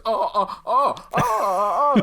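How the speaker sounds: loud, high, laughing oh; shocked audience gasps. Retold or imitated. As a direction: cry out in shocked delight. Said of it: "where the audience goes".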